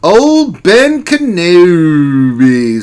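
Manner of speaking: loud, very drawn-out name; grand announcement. Pretend. Streamer introducing a chat member, grandly drawn out. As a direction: stretch the name out with mock grandeur.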